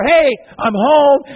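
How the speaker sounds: loud, slow, beaming call through the door; cheery homecoming Jesus. Acted. Jesus greeting his family at the door, loud and slow. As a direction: announce yourself home, bright and proud. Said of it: "Could you see him walking through the door".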